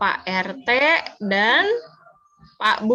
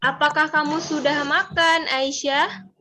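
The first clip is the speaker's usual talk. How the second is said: rising question tune on Aisyah; question intonation; 4 semitones higher, slower than usual. Shown as a demonstration. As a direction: ask gently, lifting "Aisyah" at the end. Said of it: "Contohnya gini". (intonasi bertanya, naik di akhir pada "Aisyah")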